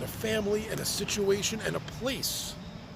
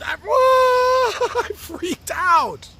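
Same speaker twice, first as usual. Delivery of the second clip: much higher and louder, stretched out, laughing; laughing outburst; 12 dB louder, much slower than usual. Real, not acted. Remembering nanny's false teeth, loud slow laughing cry. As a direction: burst out laughing as it comes back to you.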